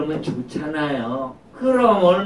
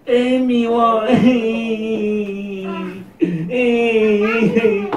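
sulky pout; 5 semitones higher, much slower than usual. Acted. Sulky child pouting.

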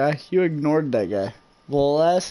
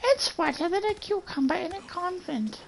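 high-pitched mocking echo; mocking repeat; 13 semitones higher than usual. Player mimicking a game character's odd line, high mocking voice. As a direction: parrot it back way up high.

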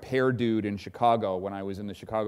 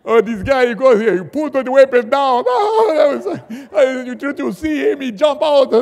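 imitating gunmen laughing, much higher and louder; laughing hysterically; 14 semitones higher, 13 dB louder than usual. Militia kids mocking the reporter who jumped out, laughing hysterically. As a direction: spill the words out through helpless laughter. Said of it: "start laughing hysterically".

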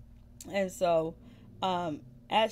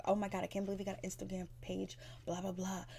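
fast frantic whisper; 5 dB quieter, faster than usual. Retold or imitated. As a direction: hiss it out panicked and quick. Little Mo freaking out quoted.

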